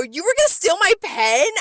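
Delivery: high, loud accusing question; exaggerated outrage at being robbed; indignant disbelief. Server retelling confronting a coworker over a pen, loudly incredulous.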